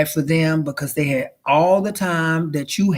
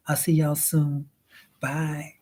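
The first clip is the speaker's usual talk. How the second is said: normal talking voice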